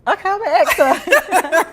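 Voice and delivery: loud, laughing through the words; can't hold back the laugh. Real, not acted. Laughs while promising to ask.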